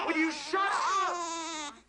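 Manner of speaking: slow, high, half-sob half-laugh; laughing through tears. Acted. Worn-out brother to the baby, slow and breaking into a laughing sob. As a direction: come apart at the end of your rope.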